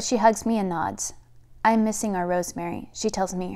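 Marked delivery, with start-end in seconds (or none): none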